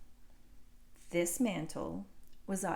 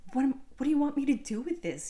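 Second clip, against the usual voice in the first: fast, louder, higher imitation of the dad; hammed-up exasperated dad; 6 semitones higher, 5 dB louder, faster than usual. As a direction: fire it off like a fed-up father.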